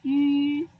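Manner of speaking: "yu" said loudly as ü with small round lips; emphatic ü. Shown as a demonstration. Teacher checking that pupils can hear ü, loud. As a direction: repeat the correct ü firmly.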